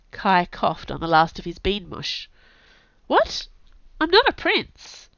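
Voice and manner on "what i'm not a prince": high, startled protest; shocked denial, pitched up. A narrator's reading. Kai startled by prince claim.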